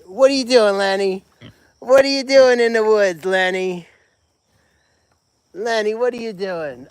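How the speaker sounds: high sing-song calling to the pig; high playful pet talk. Real, not acted. Cooing at Lenny in woods.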